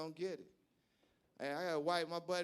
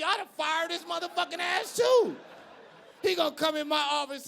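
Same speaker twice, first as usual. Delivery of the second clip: loud, higher outburst; indignant shout; 5 semitones higher, 5 dB louder than usual. Real, not acted. Comic putting himself in Trump's place, shouting. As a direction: bellow it like you'd fire him yourself.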